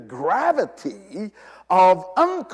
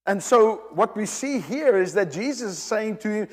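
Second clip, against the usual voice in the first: usual speaking voice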